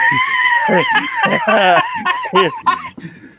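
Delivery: high shrieking laughter; can't stop laughing; loud laughing fit. Real, not acted. Cracking up at the comment.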